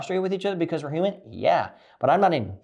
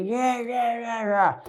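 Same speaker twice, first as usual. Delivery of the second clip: high mocking yeah yeah; a higher sneering imitation of the wife; mocking whiny brush-off; 9 semitones higher, slower than usual. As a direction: mimic her dismissive nagging, pitched up. Mocking wife's dismissive yelling.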